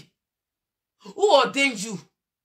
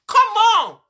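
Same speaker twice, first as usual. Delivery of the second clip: imitated pleading shout; 7 semitones higher, 8 dB louder than usual. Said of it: "I beg you say".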